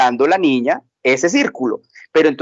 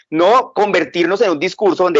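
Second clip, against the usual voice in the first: ordinary delivery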